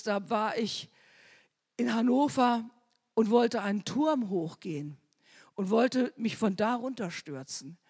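ordinary talk

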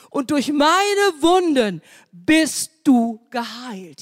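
high, loud fervent preaching, building to a cry; preaching at full force. Acted. Preacher crying out to the congregation that the Lord heals, fervent and loud. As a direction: proclaim it to the whole congregation at full voice.

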